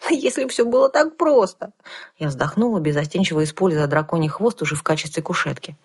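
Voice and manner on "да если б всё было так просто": put-on young girl voice. A narrator's reading. Heroine exclaims.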